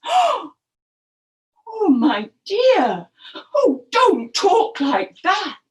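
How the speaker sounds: loud, high husbandly dismay; shocked, imploring crocodile husband. Acted. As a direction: plead in shock with a wife. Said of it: "Mister Crocodile was very shocked".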